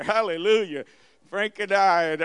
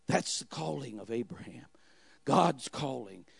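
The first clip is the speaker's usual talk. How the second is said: hushed whisper; 6 semitones lower, 7 dB quieter than usual. Real, not acted. Preacher whispering to the congregation, hushed. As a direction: breathe the words like a secret.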